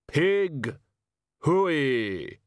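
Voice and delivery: big drawn-out pig call, falling at the end; ponderous, long-held farmyard call. A narrator's reading. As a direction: holler Pig Hooey slowly to the animals. Figure calls the pigs in earnest.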